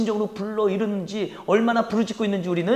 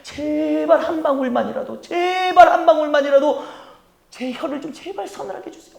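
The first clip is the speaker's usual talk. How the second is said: humble begging; 5 semitones higher than usual. Acted. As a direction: plead humbly for a single drop. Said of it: "굉장히 겸손해졌잖아요". (부자가 겸손하게 애원하며 간청)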